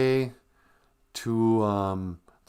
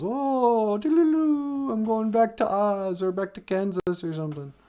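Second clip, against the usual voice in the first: mock Sarah; 13 semitones higher than usual. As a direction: put on a silly high singsong girl voice. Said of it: "And then she goes".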